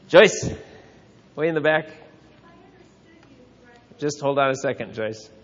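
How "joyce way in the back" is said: loud, high call of a name; sharp raised call across a room. Real, not acted. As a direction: call out to someone at the back. Calling on someone across the room.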